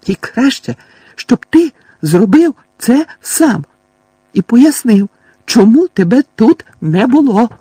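put-on old woman threatening the thief; furious scolding. A narrator's reading. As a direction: spit each word as an order. Fig hitting and scolding.

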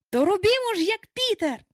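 high, eager rallying cry; rally everyone with sudden resolve; excited shout. A narrator's reading. Boys rallying each other against wolves, excited. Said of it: "вигукнули".

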